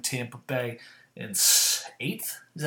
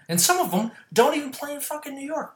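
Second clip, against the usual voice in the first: high, fast outraged burst; fed-up yelling rant; exasperated rant; 12 semitones higher, faster than usual. Real, not acted. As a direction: vent about too many New York teams. Ranting about New York teams.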